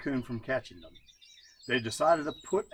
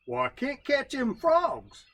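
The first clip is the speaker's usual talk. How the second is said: higher, slower, put-upon raccoon voice; Brother Coon; 12 semitones higher, 5 dB louder, slower than usual. Acted. Brother Coon excusing himself to his wife, higher and slow. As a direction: whine out the excuse. Coon's voice in folk tale.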